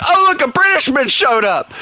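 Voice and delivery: sing-song fake astonishment; mock surprise. Real, not acted. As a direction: feign amazement at the rare find. Mock surprise in long list.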